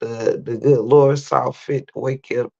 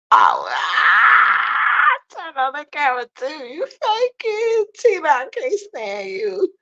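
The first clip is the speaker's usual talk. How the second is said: high laughing squeal; 13 semitones higher, slower than usual. Real, not acted. Caught by moderator Tina.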